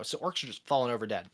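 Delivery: normal talking voice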